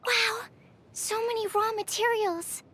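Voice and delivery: high-pitched excited wonder; delighted amazement. Acted. Researcher finds many raw materials, delighted amazement. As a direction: marvel at a lucky find.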